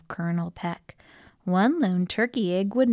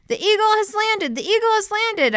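very high, loud repeated holler; yelling; 5 dB louder than usual. A narrator's reading. Detective calls for missing backup, high urgent yell. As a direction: yell desperately for help to come. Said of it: "I yelled even louder".

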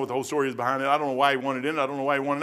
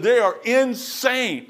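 each word slowed and pushed, higher and a bit louder; slow emphatic verdict; 8 semitones higher, 4 dB louder, much slower than usual. Retold or imitated. Pastor quoting the young saleswoman, slow and emphatic. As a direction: hammer home they are insane.

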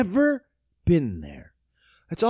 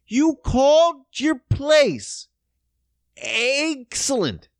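each word dragged out, louder; slow emphatic rant; 7 semitones higher, 3 dB louder, much slower than usual. Real, not acted. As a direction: spell out the name with mock outrage. Ranting at the restaurant.

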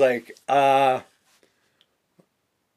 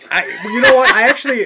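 laughing; 9 semitones higher, 9 dB louder, faster than usual. Real, not acted. Laughing while recalling.

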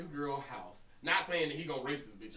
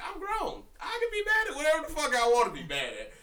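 high-pitched, louder mock outrage; indignant retort; 13 semitones higher, 6 dB louder than usual. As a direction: protest in a raised pitch, retelling an argument. Retold indignant retort to drunk friend.

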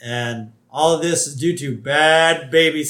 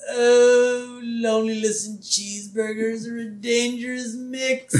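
high, drawn-out mock moan of a man with a bellyache; hammed-up high-pitched groaning complaint; mock Comic Book Guy groan; 8 semitones higher than usual. Comic book guy holding his stomach in a wheelbarrow, hammed-up moaning. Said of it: "just holding his stomach and going".

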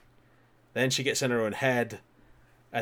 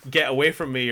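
ordinary delivery

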